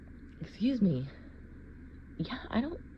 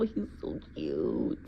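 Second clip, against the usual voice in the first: lower, slow and doting; melting; slower than usual. Acted. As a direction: linger on it, lovestruck. Melting over kitten.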